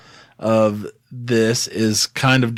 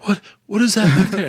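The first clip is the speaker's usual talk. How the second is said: high-pitched mock distraction cry; feigned surprise; 10 semitones higher than usual. Host acting out a trick to distract the kids, feigned surprise. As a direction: fake astonishment, voice jumping up high. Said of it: "point and be like".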